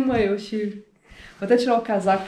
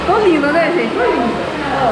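playfully delighted, a touch higher and louder; excited, showing off; 5 semitones higher, 4 dB louder than usual. Real, not acted. Showing her new short hair to viewers, excited. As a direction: show off the new look with a happy lilt.

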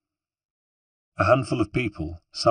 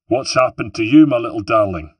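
put-on louder, higher, a rough Venetian accent; harsh Venetian accent; 4 semitones higher, 8 dB louder than usual. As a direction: greet her in a hearty harsh Venetian accent. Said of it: "in the harsh accent of the born Venetian".

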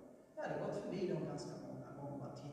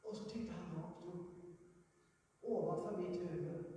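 usual speaking voice

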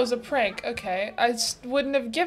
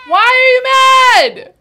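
high, drawn-out loud yell; exasperated shout; 11 semitones higher, 11 dB louder, slower than usual. Real, not acted. Reactor to the upset kid on screen, exasperated shout. Yells at child in video.

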